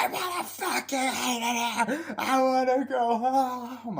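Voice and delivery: very high, loud, drawn-out wail; exaggerated bawling kid impression; mock tantrum. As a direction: wail like a child throwing a fit, hammed up. Acting Michael's tantrum, wailing to go home.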